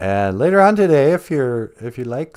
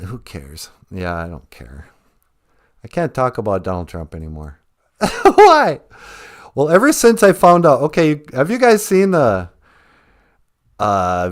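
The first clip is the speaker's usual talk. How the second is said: ordinary delivery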